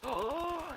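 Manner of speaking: high, drawn-in mock gasp; shocked class gasp. Classmates react to the swearing, shocked gasp. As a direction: imitate a whole room gasping. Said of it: "and then everyone goes".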